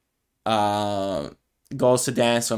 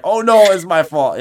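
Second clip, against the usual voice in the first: higher, louder mock dismay; mock guilt; 7 semitones higher, 9 dB louder than usual. Seeing his friend tear up, loud mock-guilty alarm. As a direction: gasp it like it's all your fault. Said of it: "I was like".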